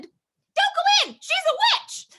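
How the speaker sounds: high, slow urgent warning; high chicken voice warning the kids; little chicken cry. Acted. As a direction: burst in high like a little hen. Chicken bursts into story.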